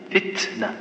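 "فتنة" breathed out with a held then lightly released ت; whispered demo. Shown as a demonstration. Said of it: "النفس تحبسه ثم تطلقه على خفيف".

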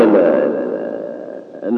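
ordinary talk

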